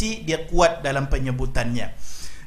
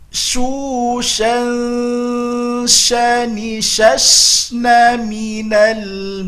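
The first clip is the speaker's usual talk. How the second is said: slow Quran recitation drill; 6 semitones higher, 4 dB louder, much slower than usual. Shown as a demonstration. Teacher leading students in a slow drill. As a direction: chant each syllable slowly and clearly.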